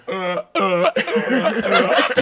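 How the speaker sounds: loud, high repeated grunts; silly mic noises. Retold or imitated. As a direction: bounce goofy grunts between two microphones. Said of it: "making noises".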